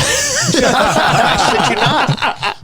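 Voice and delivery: laughing hard through the words; can barely get it out for laughing. Real, not acted. Friend laughing while starting a road rage story, cracking up.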